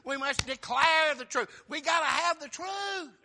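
loud, high, driving sermon cadence; fiery revival preaching; preaching at full force. Acted. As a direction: hammer each line home from the pulpit.

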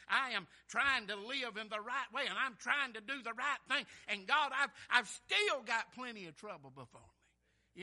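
loud, impassioned plea to God; preached appeal. Acted. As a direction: cry out David's case from the pulpit.

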